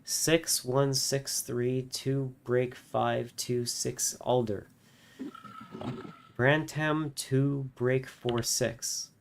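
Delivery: slow, evenly spaced number reading; mechanical announcer voice. Acted. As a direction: read it like a machine. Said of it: "announces with mechanical regularity".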